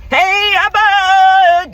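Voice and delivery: loud high comic yelp; panicked cry. Retold or imitated. Running from the thing, calling out to a friend in a panicked yelp. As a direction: shriek for help like a cartoon. Mock Abbott and Costello scare cry.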